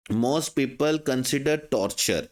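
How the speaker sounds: very slow, word by word English; teacher dictating a sentence; slow dictation. Shown as a demonstration. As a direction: read it out slowly for students to follow. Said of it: "dash समझते हैं".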